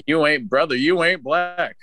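mock president. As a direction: echo the politician, dragging the last word. Second speaker echoes the impression.

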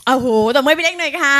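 loud, high rallying shout; ตะโกนชวนปรบมือ. Real, not acted. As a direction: whip up the crowd for applause, voice climbing. Calls audience to applaud a member. (ตะโกนปลุกเร้าผู้ฟังเสียงดังลั่น)

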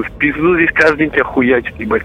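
ordinary talk, nothing marked

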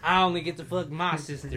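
loud, hammed-up Southern accent. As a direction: crude hillbilly impression, played loud for laughs. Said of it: "like, so southern".

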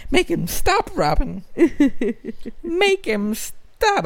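high, drawn-out mock villain voice; Batman villain. Podcaster casting the singer as Batman villain, high mock villain voice. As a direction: squeal it like a cartoon supervillain. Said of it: "Puede ser un Batman villain".

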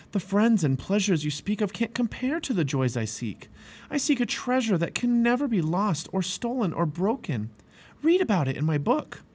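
high-pitched, impassioned appeal; earnest persuasion. A narrator's reading.

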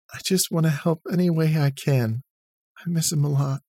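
holding back tears as she speaks; broken voice. Acted. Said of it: "Katie said with a broken voice".

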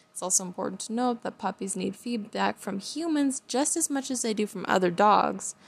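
ordinary talk, nothing marked

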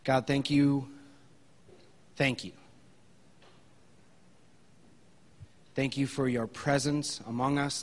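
soft, hushed prayer voice. Acted. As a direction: pray quietly and sincerely. Leads the congregation in prayer.

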